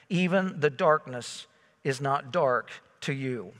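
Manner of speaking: measured reading of scripture. Acted. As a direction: read the psalm low and reverent.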